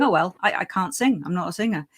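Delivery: usual speaking voice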